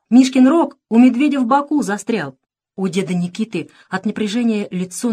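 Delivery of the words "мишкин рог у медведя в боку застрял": higher, loud excited report; crying out. A narrator's reading. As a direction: shout urgent news to grandpa. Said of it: "вскрикнул Саша". (взволнованно выкрикивает)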